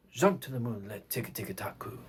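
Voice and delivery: soft, very low chant; mezzo piano. Shown as a demonstration. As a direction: chant the syllables softly and low. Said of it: "Mezzo piano".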